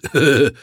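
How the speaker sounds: loud short guffaw; a big man's booming laugh; hearty laugh. Acted. Arch laughs after joke. (lautes kurzes Lachen)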